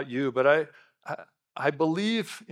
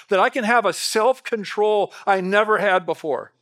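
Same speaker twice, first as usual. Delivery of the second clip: loud, ringing sermon delivery, higher and louder; full-force preaching; 7 semitones higher, 9 dB louder than usual. Acted. Pastor preaching hard to his congregation, loud and high. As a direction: preach it out to the whole church.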